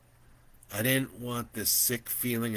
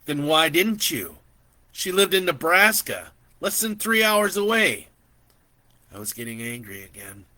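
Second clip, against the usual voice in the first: loud, rising heated accusation; angry; 8 semitones higher, 11 dB louder than usual. Acted. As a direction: let the anger build as you accuse. Narrator angry at auntie.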